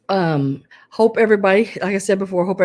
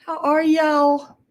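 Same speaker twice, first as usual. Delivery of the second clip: Southern accent slipping; 10 semitones higher, 5 dB louder, slower than usual. Real, not acted. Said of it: "that accent breaking through".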